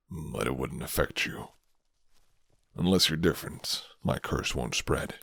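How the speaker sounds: low, soft-spoken rough stranger; a hint of a guarded werewolf offering shelter; gruff werewolf man. Werewolf reassures about bite.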